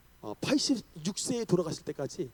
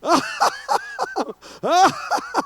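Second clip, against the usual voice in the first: long, high, loud cackle; hammed-up belly laugh in high bursts; imitated laughter; 6 dB louder than usual. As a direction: laugh uproariously instead of answering. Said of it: "말을 안 하고 웃었대요".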